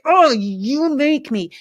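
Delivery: hammed-up blame after being cut off; mock offended driver. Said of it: "like, you could say".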